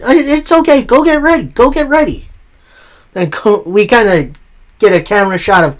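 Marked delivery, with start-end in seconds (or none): 0.0-2.3 high, quick shooing-along voice; lightly voiced Liv hurrying them off; hurried reassurance. Liv urging the guys to get ready, higher. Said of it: "Liv's then like".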